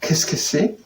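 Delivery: ordinary talk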